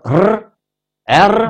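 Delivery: R as a long rolled trill. Shown as a demonstration. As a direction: roll the R hard like in Italian or Spanish. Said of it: "这些国家发音".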